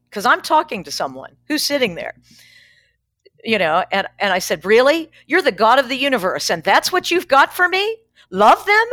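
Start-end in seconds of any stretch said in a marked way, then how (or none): 4.6-8.0 higher, louder, exasperated outburst; incredulous yelling. Retold or imitated. Yelling at God in the car, incredulous. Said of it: "I said I was yelling at God". 8.3-8.9 higher and louder, them rising in disbelief; incredulous yell. Retold or imitated. Yelling back at God's answer, incredulous. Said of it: "I said I was yelling at God".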